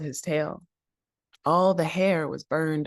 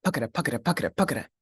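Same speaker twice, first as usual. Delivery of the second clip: running rhythm; much faster than usual. Acted.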